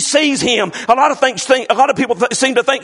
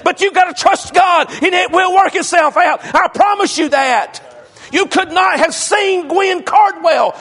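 full force preaching; 6 semitones higher than usual. Acted. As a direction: preach it hard to the congregation.

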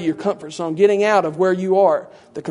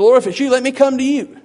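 raised, loud acted plea; earnest disciple's cry, higher and louder; calling out; 6 semitones higher, 6 dB louder than usual. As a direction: call across the water to the Lord. Peter calls to Jesus, acted.

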